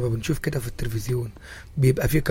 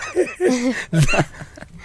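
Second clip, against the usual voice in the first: hearty laugh; 11 semitones higher than usual. Real, not acted. Host laughs at the request.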